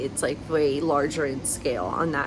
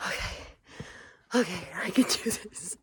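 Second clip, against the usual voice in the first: whispering; 6 dB quieter than usual. Real, not acted. Quiet whispering at early hike.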